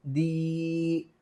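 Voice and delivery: दी held on a long steady ee; drawn-out दी. Shown as a demonstration. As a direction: stretch दी right out. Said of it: "खींच कर पढ़ें". (दी को खींच कर पढ़ना)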